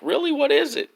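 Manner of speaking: put-on high, eager little boy; eager kid voice. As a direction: perk up like an excited kid.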